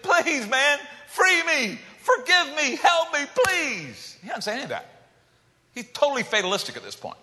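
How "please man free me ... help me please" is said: high pleading plea, a hint of desperation. Man at Jesus' feet begging, high and pleading. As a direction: beg for help in a raised voice. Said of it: "come to Jesus and say".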